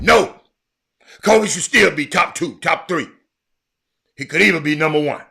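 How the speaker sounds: loud, high, fast argumentative yell; heated shout. Retold or imitated. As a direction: shout them down on air. Retold heated argument on show.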